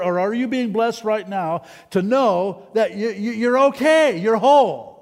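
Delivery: high, impassioned preacher building in force; worked-up pulpit proclamation, loud on the key phrases; excited, emotional preaching. Real, not acted. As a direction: preach it fervently, pitch riding high. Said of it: "am I getting too excited and emotional".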